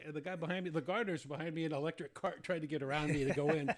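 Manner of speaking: normal talking voice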